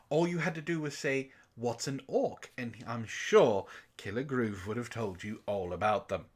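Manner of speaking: ordinary talk, nothing marked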